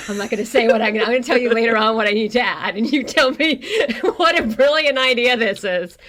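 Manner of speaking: loud, talking through laughter; real laughing fit. Real, not acted. Co-host teasing a secret idea, laughing hard. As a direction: push the words out while cracking up. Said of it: "[name]'s dying".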